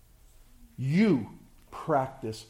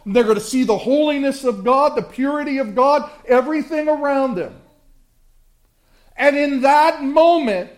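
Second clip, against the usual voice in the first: loud, high, driving sermon voice; thundering pulpit delivery; full-force preaching; 9 semitones higher, 12 dB louder than usual. Acted. Preaching build to judgment.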